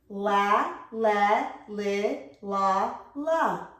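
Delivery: La, le, li, la, la as separate blended syllables; blend demonstration. Shown as a demonstration. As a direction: model each L blend in "La, le, li, la, la", pausing between. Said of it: "I'm gonna do the L's next".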